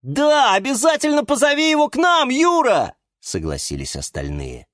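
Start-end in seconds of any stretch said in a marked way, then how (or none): 0.0-2.9 very high, loud, quick cheer; eager agreement. A narrator's reading. Houseplants beg Юра to invite his friend, high excited cries. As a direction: pipe up excitedly like little creatures.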